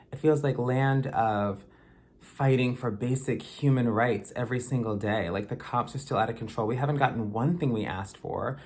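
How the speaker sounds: ordinary talk, nothing marked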